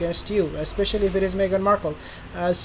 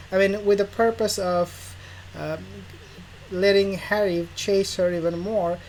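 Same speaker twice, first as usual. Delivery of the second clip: usual speaking voice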